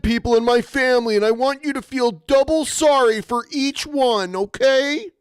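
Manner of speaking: high, fast mocking whine; mocking whiny voice. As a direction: parody a self-pitying plea. Mocking the streamer's grief plea.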